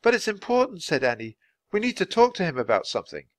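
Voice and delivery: put-on high, earnest girl's voice; insistent girl Annie. A narrator's reading.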